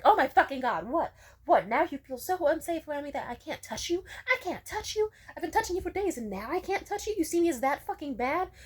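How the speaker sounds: imitating a boyfriend's high, loud furious rant; furious outburst. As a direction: throw his angry disbelief back, loud and high. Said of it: "he immediately flipped shit and was like".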